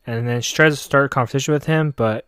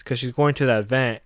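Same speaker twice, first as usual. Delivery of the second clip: ordinary delivery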